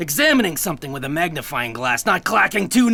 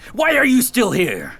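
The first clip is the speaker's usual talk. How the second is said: impatient snap; 6 semitones higher, 5 dB louder than usual. Acted. Chief impatient with lingering agent.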